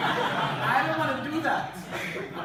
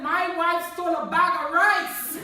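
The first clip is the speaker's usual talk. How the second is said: higher, louder, slow, laughing through it; outraged old guy; 7 semitones higher, 7 dB louder than usual. Retold or imitated. Old man jumps up in court, loud and laughing.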